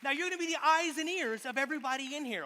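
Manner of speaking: booming showman, pitched high; loud stage voice. Real, not acted. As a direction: project it to the back row. Addressing the volunteer onstage.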